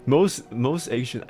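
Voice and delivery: usual speaking voice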